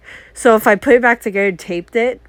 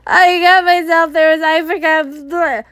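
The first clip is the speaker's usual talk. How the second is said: tearful mumbling; 6 semitones higher than usual. Retold or imitated. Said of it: "mumbling and slurring my words".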